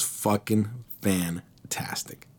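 slow, breathy whisper. Real, not acted. Telling the crowd how it felt, in a slow whisper. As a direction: savor it like a secret.

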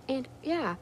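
mock troll voice. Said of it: "And he says".

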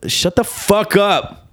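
slow, high-pitched outburst; exasperated retort. Retold or imitated. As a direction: draw it out in exasperation. Acted retort to online critics.

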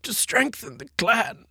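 high, weak dying gasp; dying villain. Acted. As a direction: gasp out last words between coughs. Dying villain's last words.

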